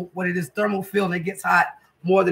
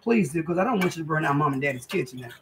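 normal talking voice

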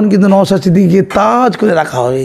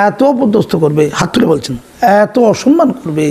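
ordinary talk

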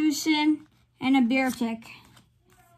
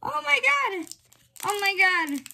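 high, overexcited exclamation; overwhelmed excitement; 6 semitones higher than usual. Real, not acted. Card opener after the best pull, overwhelmed. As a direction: exclaim it, unable to calm down. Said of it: "I'm just trying to calm myself down".

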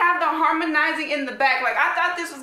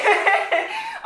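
a high, loud burst of laughter; delighted laugh; 4 dB louder than usual. Real, not acted. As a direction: crack up suddenly.

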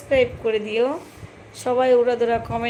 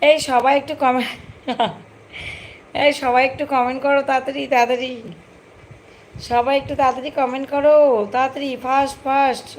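raised, hurried calling out; urgent loud urging; 3 semitones higher, 4 dB louder than usual. Real, not acted. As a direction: press everyone to comment quickly. Urging viewers to comment fast.

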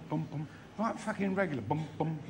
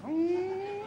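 high muffled "mm" through a gag; gagged hum; 10 semitones higher than usual. Acted. Gagged passenger in the stunt plane, high muffled hum.

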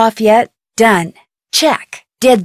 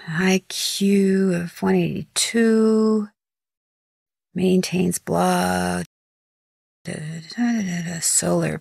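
whisper; 3 semitones lower, 8 dB quieter, slower than usual. Real, not acted. Reading a file to herself.

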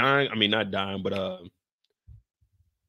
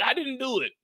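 Drew denying; 12 semitones higher than usual. Retold or imitated. Drew tells Tariq he didn't do it, pitched up. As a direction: play Drew's weak protest. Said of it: "And Drew be like".

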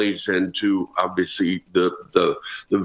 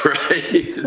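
real laughter breaking through the words; laughing hard, voice jumping up high; 13 semitones higher, much slower than usual. Real, not acted. Former teammate laughs retelling Darren's pitching-mound visit. Guest laughing at his own story.

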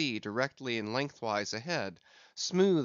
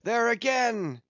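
high, loud, lingering, methodic calls; long-drawn cry; 9 semitones higher, 7 dB louder, slower than usual. A narrator's reading. Captain calling each spout of the whale, long-drawn cries. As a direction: draw each call out in the same measured tone. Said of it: "he cried out in long-drawn, lingering, methodic tones".

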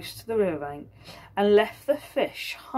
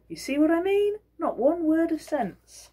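knowing aside; 10 semitones higher, 3 dB louder than usual. Acted. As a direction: say it with a told-you-so look. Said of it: "a look as if to say".

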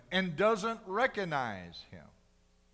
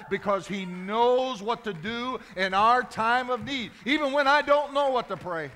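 fervent preaching; 4 semitones higher, 7 dB louder than usual. Acted. Preacher to Sister Kim and the church, fervent preaching. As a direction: build to a climax for the congregation.

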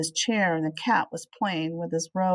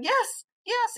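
much higher, eager yes; cried out. A narrator's reading. Flugel joyfully claiming his cat, much higher and eager. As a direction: burst out with relief. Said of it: "suddenly he cried".

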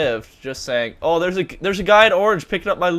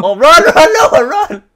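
frantic high shout of run; being chased; 10 semitones higher, 14 dB louder, slower than usual. Real, not acted. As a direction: yell at him to run, urgently. Urging teammate to flee.

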